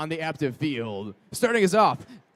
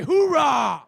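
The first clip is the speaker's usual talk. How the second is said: louder call for a cheer; crowd hype shout; 7 semitones higher, 7 dB louder than usual. Real, not acted. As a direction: demand a bigger cheer. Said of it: "that was weak".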